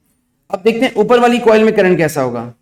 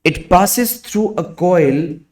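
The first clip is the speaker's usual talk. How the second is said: slow, word by word dictation; dictating; much slower than usual. Acted. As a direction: dictate slowly for students to write. Dictation continues.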